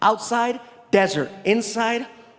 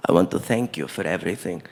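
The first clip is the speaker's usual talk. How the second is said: very low, formal royal invitation; prince's voice; 9 semitones lower than usual. Retold or imitated. Prince inviting the comic to his palace, low and formal. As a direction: speak deep and measured, like a prince. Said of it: "He looks at me and he says".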